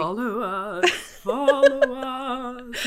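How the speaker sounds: slow, eerie drawn-out call; spooky ghost voice. Acted. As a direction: drone it like a ghost. Teasing spooky repetition.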